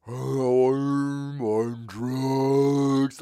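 very slow drawn-out stoned drawl; imitating a comic's slowed-down drug voice; Robin Williams slow talk. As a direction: stretch every word like you're high. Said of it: "He'd talk real slow, like he's on drugs".

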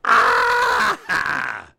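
high piercing scream; cat's anguished shriek. Acted. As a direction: let out a tortured yowl. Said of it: "Here's what's going on inside that cat's head".

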